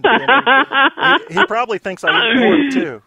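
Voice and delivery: loud, high, talking through real laughter; laughing. Real, not acted. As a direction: laugh the words out. Host laughing at the joke.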